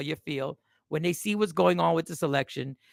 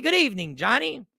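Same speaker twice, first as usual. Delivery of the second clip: usual speaking voice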